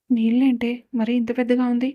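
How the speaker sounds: ordinary delivery, nothing marked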